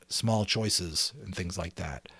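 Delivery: ordinary delivery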